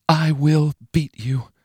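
high, slow, menacing vow. Acted. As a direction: say it like a cold promise. Inner vow repeated.

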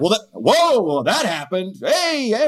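playfully upbeat calm parent; mock breezy calm. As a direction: brush it off cheerfully for the kid. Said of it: "you have to be like".

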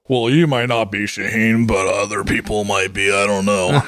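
lightly voiced tough guy; a hint of swagger, tough guy act. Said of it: "That's my tough guy voice".

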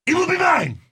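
high, loud belt claim; wrestling promo shout. Pretend. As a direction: roar it like a wrestler.

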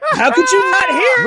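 high, loud disbelief; incredulous outburst. Real, not acted. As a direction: cry out in disbelief, pitch way up. Incredulous at co-host missing the joke.

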